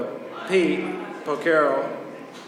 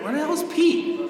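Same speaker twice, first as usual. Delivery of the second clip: incredulous high outcry; 7 semitones higher than usual. Retold or imitated. Demanding where Pete went.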